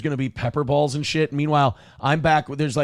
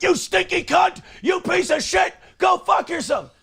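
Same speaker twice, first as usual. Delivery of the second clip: much higher, yelling insults at the bus; raging shout. Retold or imitated. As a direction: scream abuse at the passing bus. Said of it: "you're like".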